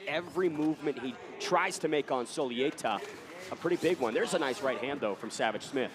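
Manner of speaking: ordinary talk, nothing marked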